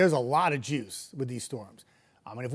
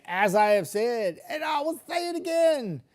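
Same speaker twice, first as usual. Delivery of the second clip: emphatic rising shout; 7 semitones higher than usual. Real, not acted. Emphatic weather warning.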